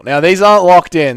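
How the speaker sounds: usual speaking voice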